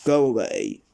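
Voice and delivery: loud, rude dismissal. A narrator's reading. Mister Uppity dismissing the goblin, rude and loud. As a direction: send them off rudely and loudly. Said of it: "said Mister Uppity rudely".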